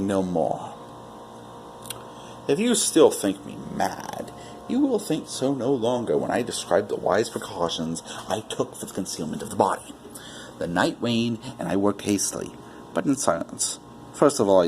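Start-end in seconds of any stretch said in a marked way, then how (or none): none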